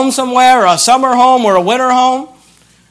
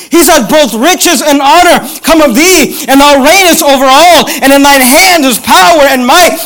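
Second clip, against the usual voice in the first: raised, driving pulpit proclamation; emphatic preaching; 5 semitones higher, 3 dB louder than usual. Real, not acted. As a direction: preach the verse with rising force. Preaches at full force.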